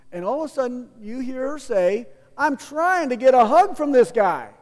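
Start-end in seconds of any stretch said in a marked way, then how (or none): 2.4-4.5 louder, slightly higher, calling out for attention; mock-indignant public appeal, lightly acted; loud hug plea. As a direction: announce it to everyone around. Woman loudly announces wanting a hug.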